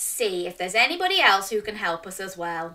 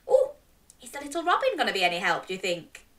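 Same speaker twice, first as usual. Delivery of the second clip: ordinary delivery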